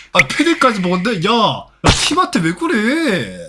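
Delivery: high-pitched, incredulous protest; indignant outcry. Real, not acted. Protesting abuse of a teammate.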